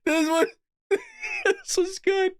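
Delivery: high voice breaking up with laughter; laughing hard. Real, not acted. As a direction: struggle to speak through real laughter. Still cracking up at clip.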